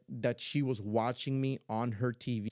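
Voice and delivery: ordinary delivery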